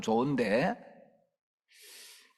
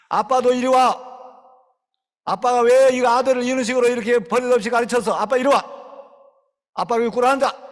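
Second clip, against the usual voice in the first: much louder, higher tirade; mock furious scolding; 10 semitones higher, 10 dB louder than usual. Preacher scolding the boy's father before the child, loud and high. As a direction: tear into the dad for show.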